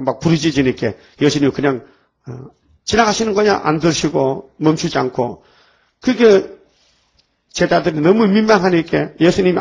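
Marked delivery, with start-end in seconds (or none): none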